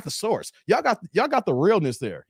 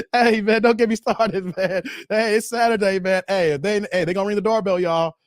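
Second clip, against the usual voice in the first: ordinary delivery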